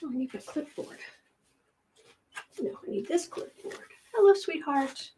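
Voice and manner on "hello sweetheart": pet talk. Real, not acted. Greeting her cat.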